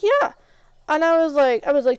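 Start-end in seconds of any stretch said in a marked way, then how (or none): 0.0-0.3 very high, chirpy yeah; of course, silly; obvious yeah. Retold or imitated. To the owner asking about the training, a chirpy yeah. Confirming she was just training.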